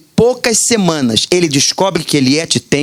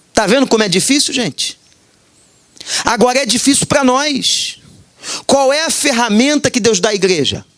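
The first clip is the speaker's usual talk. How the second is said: higher-pitched earnest exhortation; preaching; 5 semitones higher than usual. Acted. Pastor urges congregation to seek discernment, earnest preaching. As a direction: call the church to pray for the gift.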